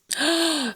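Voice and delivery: high gasp of surprise; delighted gasp. Real, not acted. Gamer seeing beloved Nia call, high delighted gasp. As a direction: gasp at a beloved friend turning up.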